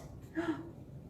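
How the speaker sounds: quiet storyteller's gasp; sharp intake of breath in suspense; soft mock-shocked gasp; dramatic gasp. Gasp as dog prepares to leap.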